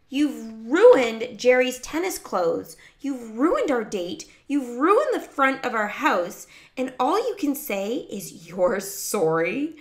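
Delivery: loud, high, indignant tirade; outraged big sister; angry scolding. A narrator's reading. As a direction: hit each accusation hard.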